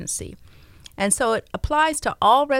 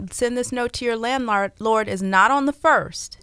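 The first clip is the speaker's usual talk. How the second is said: ordinary talk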